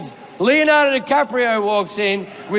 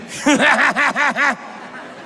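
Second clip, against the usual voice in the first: rapid, staccato ha-ha-ha; imitated billionaire laugh; 4 semitones higher than usual. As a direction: mimic a tycoon's rattling guttural laugh. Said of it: "And then he just went".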